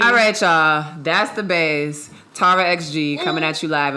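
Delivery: radio host outro. Acted. Podcast outro host read.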